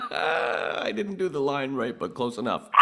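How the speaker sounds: high, loud, coming out of a laugh; laughing through words. Real, not acted. Host admitting a botched line, high laughing delivery. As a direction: squeeze the words out after cracking up. Cracking up at own impression.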